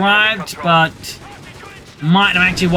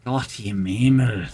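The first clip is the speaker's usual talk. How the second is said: low, slow German aside; a hint of a dismayed German officer; mock German exclamation; 9 semitones lower, 6 dB quieter, slower than usual. Caster voicing panicked German side.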